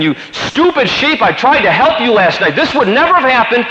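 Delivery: high-pitched angry retold yell; angry outburst. Farmer to sheep after losing the lambs, angry yelling. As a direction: re-enact exasperated yelling at the sheep. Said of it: "I was so mad and angry".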